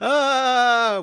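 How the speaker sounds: loud, high mock scream; imitated scream. Co-host copies the man screaming on the rooftop, mock scream. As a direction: belt out a hammed-up high scream. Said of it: "where he's screaming".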